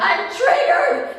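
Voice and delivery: high, drawn-out hysterical scream; hammed-up meltdown yell. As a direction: shriek it as an accusation. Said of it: "You don't just scream".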